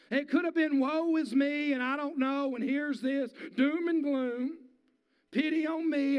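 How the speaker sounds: loud, high, driving sermon voice; full-force preaching. Real, not acted. Pastor preaching at full force to his congregation.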